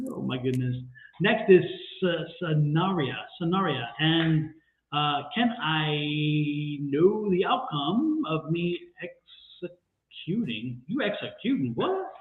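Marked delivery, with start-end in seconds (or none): 5.3-10.8 slow, halting, sing-song reading of the question; tripping over the wording of a viewer's question; stumbling read. Real, not acted. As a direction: stumble through the words like reading them for the first time. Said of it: "I'm stumbling over those words".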